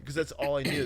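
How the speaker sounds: ordinary delivery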